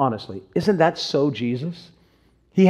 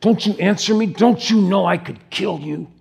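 higher-pitched, exasperated demand; frustrated, incredulous questioning in a put-on voice; frustrated Pontius Pilate; 5 semitones higher than usual. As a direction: act out an indignant ruler's threat. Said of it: "Pontius Pilate is so frustrated".

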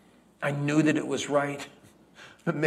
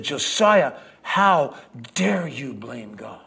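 fiery rebuke; 4 dB louder, slower than usual. Acted. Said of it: "with fire in her eyes".

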